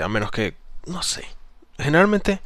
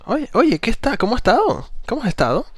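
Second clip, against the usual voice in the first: very high, louder, overeager questioning; ascending submissive tone; 14 semitones higher, 5 dB louder than usual. Shown as a demonstration. Coach parodying how not to greet, high ascending tone. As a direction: send every phrase up high, gushing. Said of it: "en vez de decir".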